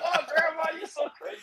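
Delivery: high mocking granddaughter. Retelling the meme, mocking the granddaughter voice. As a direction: squeak it like a teasing granddaughter. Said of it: "And then she's like".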